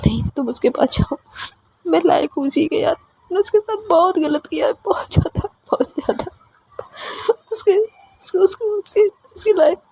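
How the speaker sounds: very high voice cracking into crying, fading to a whisper; breaking into sobs. A narrator's reading. Chiku blaming herself to Anandi, sobbing very high. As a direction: sob out the self-blame. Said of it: "वो बहुत रो रही होती है".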